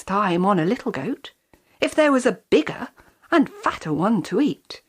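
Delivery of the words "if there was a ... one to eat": usual speaking voice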